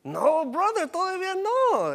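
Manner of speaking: high-pitched, insistent mock protest; emphatic objection. Preacher telling a brother God's love is bigger, insistent high protest. Preacher rejecting Saturn as comparison.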